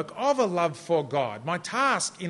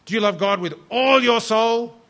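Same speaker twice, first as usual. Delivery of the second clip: "all" stretched out high and loud; big emphasis on "all"; 5 semitones higher, 8 dB louder than usual. Retold or imitated. Principal pressing the student a second time, booming emphasis on "all". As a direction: hit "all" hard and hold it. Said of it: "big emphasis on the word all".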